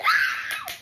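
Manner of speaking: very high, loud squeal; mock scream. Reliving being hidden in the boys' car, high mock scream. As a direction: let out a hammed-up shriek.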